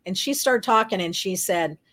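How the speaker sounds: ordinary delivery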